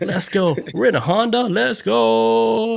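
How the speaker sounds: high excited "let's go"; hyped spirit voice. Pretend. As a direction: cheer like a rowdy ghost. Spirits cheering in a car.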